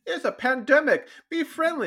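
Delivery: high-pitched mocking imitation; mock app voice. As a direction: mimic a chirpy app slogan way up high. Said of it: "the only one that's like".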